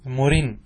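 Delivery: مرن as "murin", ending on n; ya dropped, tanween. Shown as a demonstration. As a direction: close مرن on a clear nun sound. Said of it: "تحذف".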